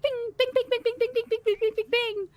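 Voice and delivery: high repeated bing chime; ping it out like a donation bell, high; imitated chimes. GM imitating the donation machine chiming, high bings. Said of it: "you can hear, like".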